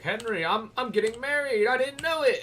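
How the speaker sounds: high, loud whiny friend voice; whiny Hans. Retold or imitated. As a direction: mimic a panicking groom. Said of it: "He's like".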